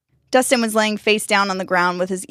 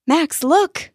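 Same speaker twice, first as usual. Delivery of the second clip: excited, high call; calling him over, thrilled; 9 semitones higher, 3 dB louder than usual. Acted. Danny excitedly points out.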